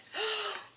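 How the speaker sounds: high sudden gasp of recognition; sharp gasp. Retold or imitated. Realizing who the woman beside her is, sharp gasp. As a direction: gasp as it suddenly hits you.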